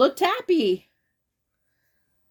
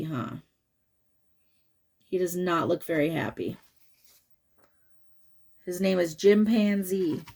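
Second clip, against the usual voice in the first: ordinary delivery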